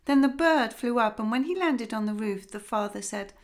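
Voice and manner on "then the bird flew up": usual speaking voice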